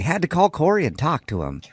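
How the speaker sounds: swooping, singsong pitch; odd affected delivery. Pretend. Host explains the call to Corey in an odd affected singsong. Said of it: "I don't know why I said it like that either".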